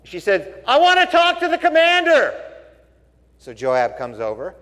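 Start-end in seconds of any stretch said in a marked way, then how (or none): 0.7-2.3 high, loud woman's voice calling from the wall. Retold or imitated. Woman on the wall calls to the commander, high and loud.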